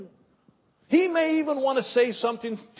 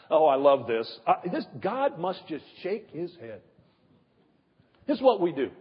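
ordinary talk

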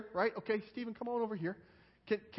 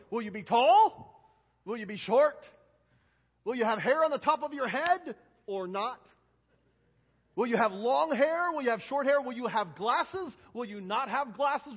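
put-on slow, bright questions to kids; lively children's sermon voice; talking to children; 3 semitones higher, 6 dB louder than usual. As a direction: draw the kids in gently.

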